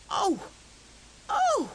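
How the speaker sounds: high, rising wail; dismayed cry. A narrator's reading. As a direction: wail at the empty table. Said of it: "cried Hare".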